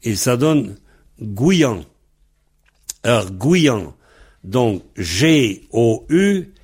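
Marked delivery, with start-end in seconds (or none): 1.2-3.9 "gouillan" with an I glide between "gou" and "an"; I intercalé. Shown as a demonstration. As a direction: slip an I into the middle of "gouillan". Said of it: "il y a un I entre gou et an". (« gouillan » avec un I entre gou et an)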